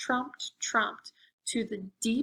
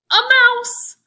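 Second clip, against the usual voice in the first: much higher, much louder surprise; excited reveal; 13 semitones higher, 13 dB louder than usual. A narrator's reading. Telling kids the scary thing is a mouse, loud and high. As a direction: shout it as the big funny twist.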